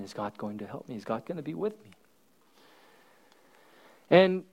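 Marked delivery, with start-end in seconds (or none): 0.0-1.9 softer, hushed question to God; quiet pleading. Retold or imitated. Rudy asking the priest softly about God. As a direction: ask it low and unsure. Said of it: "And he asks".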